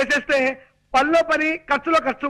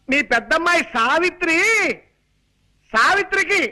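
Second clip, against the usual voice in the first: exaggerated projected voice; 3 semitones higher than usual. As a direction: spell out the news for a deaf listener. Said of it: "మామూలుగా చెప్పొచ్చు కదా".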